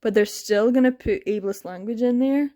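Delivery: ordinary talk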